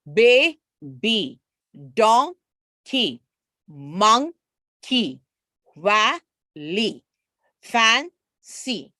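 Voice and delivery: put-on each word from "Baby" to "candy" in two beats, ending on a long ee; first half rises, gap, then falling ee, as in "don... key"; split into syllables. Shown as a demonstration. Teacher showing the long E, "candy" as can, gap, dee. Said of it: "that long E sound".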